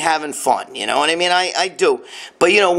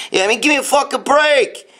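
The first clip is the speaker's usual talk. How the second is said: ordinary talk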